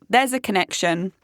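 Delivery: ordinary delivery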